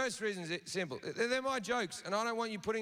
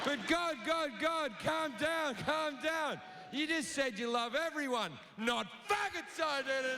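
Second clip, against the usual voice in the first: higher, soothing-pleading voices; higher urgent calming tone; placating followers; 7 semitones higher than usual. Acted. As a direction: try to calm an angry boss.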